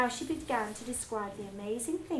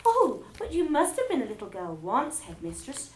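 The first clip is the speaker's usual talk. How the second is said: ordinary delivery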